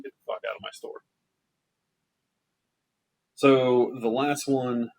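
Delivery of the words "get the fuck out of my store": hissed dismissal. Retold or imitated. Kicking customer out.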